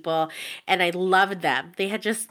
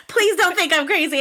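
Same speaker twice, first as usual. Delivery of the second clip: higher, laughing through "Please don't think I'm crazy"; 7 semitones higher than usual. Retold or imitated. Fan repeating her note to the cohost, laughing. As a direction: giggle through the plea.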